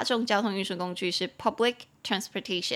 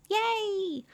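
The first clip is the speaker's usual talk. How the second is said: high, drawn-out little cheer; excited cheer; 12 semitones higher than usual. Real, not acted. Teacher celebrates finishing the sentence, high excited cheer. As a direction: let out a long high yay.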